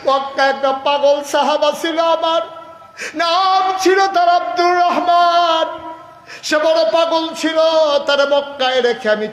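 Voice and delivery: high, loud impassioned lament; full-force preaching. Acted. Prophet recalling his companion left in Mecca, loud high chant. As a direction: cry out in longing for a lost companion.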